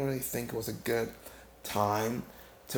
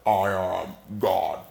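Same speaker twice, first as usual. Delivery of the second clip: slow, loud, lower-pitched thundering deity; mighty booming God voice; 4 semitones lower, 7 dB louder, much slower than usual. Retold or imitated. Storyteller imitating God's thundering voice from the garden, mighty and booming. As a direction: boom it out slowly like an almighty God. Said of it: "really mighty, like".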